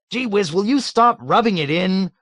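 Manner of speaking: high, loud whine of exasperation; exasperated cry. A narrator's reading. Colonel crying out at his deputy's teasing. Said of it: "Colonel Cathcart cried".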